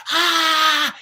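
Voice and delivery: loud, high yelled "ah"; imitated mom's scream. Mom screaming at her daughter on the field, imitated scream. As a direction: scream like a furious sideline parent. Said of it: "the mom was screaming at her".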